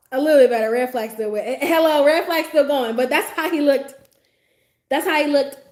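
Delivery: loud and high with disbelief; excited. Real, not acted. Recounting his outfit to viewers, loud and high disbelief. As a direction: pile it on, half laughing.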